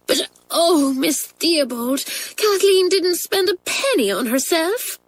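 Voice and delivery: put-on a young girl pleading for her friend; pleading schoolgirl. Pat begging Miss Theobald to spare Kathleen, pleading girl voice.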